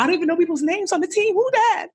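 very high, fast, flustered questions; panicked. Retold or imitated. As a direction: rattle it off in a panic. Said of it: "I was scared to be like".

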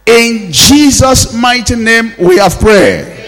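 loud, ringing preacher's declarations; forceful revival-meeting prayer; full-force prayer. Acted. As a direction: drive each prayer line out to the congregation. Leads congregation in forceful prayer.

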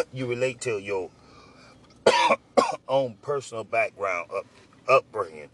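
coughing fits mid-sentence; choking on pork skins while talking; choking on food. Real, not acted. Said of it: "I just ate some skins. They choking me right now".

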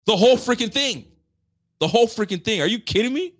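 high, loud, incredulous outburst; exasperated outrage. Real, not acted. Host answering the writer of this article, exasperated outrage. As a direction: hit it high, appalled.

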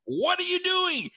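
very high, drawn-out cry; exasperated disbelief. Retold or imitated. Fan to player who stayed inbounds, exasperated. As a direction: wail it slowly at the player.

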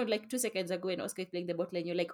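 ordinary talk, nothing marked

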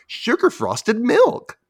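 put-on high, slow sing-song girl; drawn-out sweet ad voice; little girl voice. Girl in the ad naming her cereal, high and slow. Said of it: "she says".

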